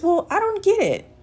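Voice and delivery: high, baffled "I don't get it"; baffled woman voice. Retold or imitated. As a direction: play her confusion pitched high. Woman baffled by single answer.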